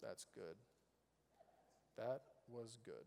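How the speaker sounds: flat, barely audible "that's good"; unimpressed murmur. Pretend. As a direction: shrug it off, no excitement at all. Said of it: "you just kinda give one of these".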